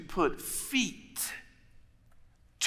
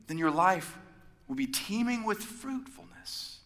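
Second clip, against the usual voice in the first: easygoing Jesus; 8 semitones higher than usual. Retold or imitated. Jesus voiced in retold scene.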